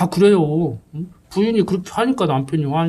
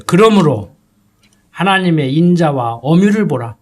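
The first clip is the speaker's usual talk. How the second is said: scripture reading; 4 dB louder than usual. Acted.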